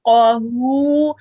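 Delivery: क़हू slowly with a clear, voiced ha. Shown as a demonstration. Teacher showing students a clear ha. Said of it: "हा को वाजे करने की कोशिश करें".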